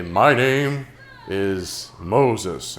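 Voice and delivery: slow, grand announcer voice as Moses; a lightly voiced solemn recorded narrator; booming exhibit voice. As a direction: drag it out like a museum narrator. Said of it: "there's a loud voice".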